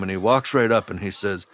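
usual speaking voice

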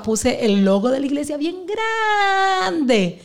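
fighting back tears as she remembers; voice breaking. Real, not acted. Recalling hard years, emotional. (con la voz quebrada por la emoción)

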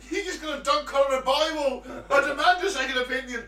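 high, loud protest through laughter; laughing mock outrage. Co-host plays along as the patient, laughing outrage. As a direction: shout the complaint while cracking up.

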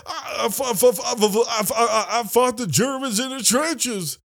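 dad bragging he fought the Germans; imitating the dad. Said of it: "and he's like".

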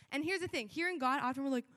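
ordinary delivery

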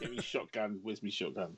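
soft, lightly voiced old farmer; grumpy old farmer. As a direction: mutter it like a cranky old farmer. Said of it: "Victor Meldrew stage of life".

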